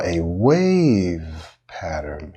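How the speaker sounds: "wave" stretched long in a rising and falling swoop; slow, exaggerated pitch arc on "wave"; inflected up and down. Shown as a demonstration. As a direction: draw "wave" out like a curve. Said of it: "notice how when I inflected up".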